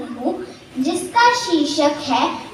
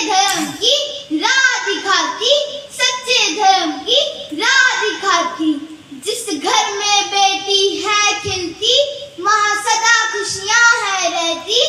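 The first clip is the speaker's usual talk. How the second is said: sing-song verse, each line drawn to a cadence; poem recitation; 5 semitones higher than usual. Acted. Reciting a poem on daughters to an audience, sing-song recitation. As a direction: recite in measured, lilting lines.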